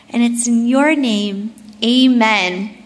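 hushed, reverent closing prayer. Acted. Closing prayer before the talk.

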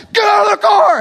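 very high, full shout of a furious cop; screaming order. Retold or imitated. Retold cop cutting off excuses, screaming. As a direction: bellow the command at a stalling driver. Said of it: "He's screaming".

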